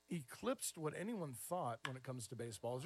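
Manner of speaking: ordinary delivery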